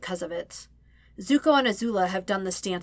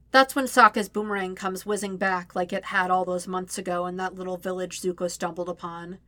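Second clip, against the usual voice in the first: ordinary delivery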